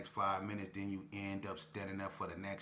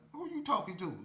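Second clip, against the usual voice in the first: high, louder mock-tough question; defiant challenge; 12 semitones higher, 4 dB louder than usual. To K P bursting in, mock-tough high challenge. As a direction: pretend not to be scared.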